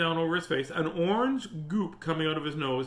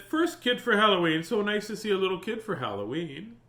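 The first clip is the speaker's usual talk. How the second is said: lady greeting; 6 semitones higher, 4 dB louder than usual. A narrator's reading. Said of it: "A lady opened the door".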